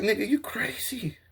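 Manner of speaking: hushed disbelieving whisper; whispering to a friend. Retold or imitated. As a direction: breathe it in awe. Retold whisper.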